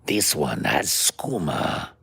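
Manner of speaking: low, slow put-on voice selling moon sugar; lazy, drunk hawker's voice, slow and low; drunk fairy character. A drunk fairy offering moon sugar to a stranger, slow and low. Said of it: "A drunk, short American fairy".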